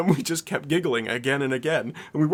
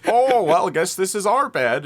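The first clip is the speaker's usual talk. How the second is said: loud, higher mock buddy voice; imitated buddy; 6 semitones higher, 6 dB louder than usual. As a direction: lightly play the buddy claiming his spot. Acts buddy claiming the bed.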